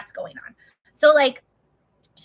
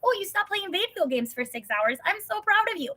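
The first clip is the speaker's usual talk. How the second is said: put-on much higher, bright praise like to a small child; patronizing praise; 9 semitones higher than usual. Husband praising his depressed wife like a child, much higher. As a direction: cheer her on like a toddler. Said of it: "Or, like".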